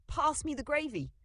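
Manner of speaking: high, exclaimed dinner-table demand; dad's voice. A narrator's reading. Grandma speaking with dad's swapped voice, exclaiming. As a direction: call out like a dad wanting gravy. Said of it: "Dad's voice came out".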